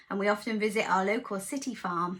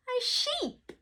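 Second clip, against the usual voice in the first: ordinary delivery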